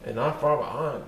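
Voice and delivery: plain reading voice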